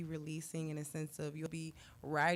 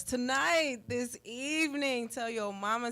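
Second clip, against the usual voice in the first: louder, higher hype for tonight's event; excited promo; 5 semitones higher, 6 dB louder than usual. Real, not acted. Guest urging listeners to come tonight, excited and loud. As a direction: sell it to the listeners with energy.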